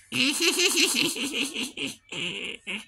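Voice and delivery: high witch cackle. Acted. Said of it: "the laugh from the witch".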